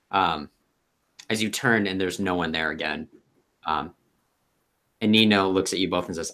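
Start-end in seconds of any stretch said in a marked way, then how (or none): none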